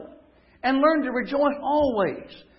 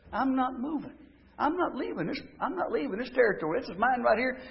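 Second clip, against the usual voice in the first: hushed, determined vow; a quiet, firm promise to yourself; quiet resolve; 2 semitones higher, 6 dB quieter than usual. Acted. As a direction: say it softly but immovable. Voiced resolve, dropped quiet.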